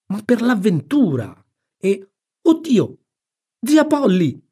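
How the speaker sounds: put-on high boyish exclamations; excited, then startled. Tom boasts of adventure then sees aunt, startled cry.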